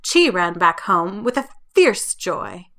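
plain reading voice

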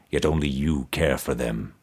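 low, soft, toneless voice of a buried skull; resonant toneless skull. A narrator's reading. Buried emperor's skull answering Mordecai, low resonant toneless voice. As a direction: intone it without any tune, deep in the earth. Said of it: "answered in a resonant voice, toneless".

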